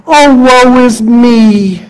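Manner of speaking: slow, drawn-out whine of woe; mock self-pitying wail to God; whiny mock lament. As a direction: ham up the self-pity. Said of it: "you're blaming God".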